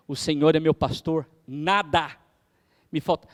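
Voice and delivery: "O Senhor é meu pastor" stressing "meu", pauses before "nada" and "Me falta"; broken into pauses, weight on "meu"; halting phrases leaning on "meu"; intonation demo. Shown as a demonstration. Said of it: "Cada entonação dessa".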